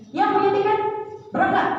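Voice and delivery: high, firm call to the group; firm announcement. Retold or imitated. Group leader firmly ordering ticket holders to board, high and firm. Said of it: "Teteh tegaskan".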